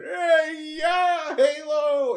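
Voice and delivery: very high, loud mock fan scream. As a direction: shriek like an overexcited fan at a show. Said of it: "you don't want fans and they're going".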